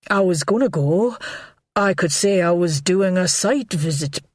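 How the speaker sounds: lightly voiced jittery man, stammering; a hint of a panicky lover fumbling for excuses; nervous Godfrey. Nervous Godfrey pleads with Anne.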